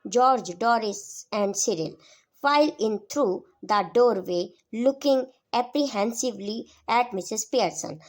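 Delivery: slow, loud, deliberate reading of a play text; slow read-aloud. A narrator's reading. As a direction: read each word out slowly to the class. Reads stage direction aloud.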